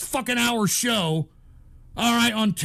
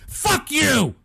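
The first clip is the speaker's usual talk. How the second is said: sharp, higher outburst at the chat; angry yell; 4 semitones higher, 3 dB louder than usual. Real, not acted. Streamer to ungrateful chat viewers, angry yell. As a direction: yell it at the people baiting you.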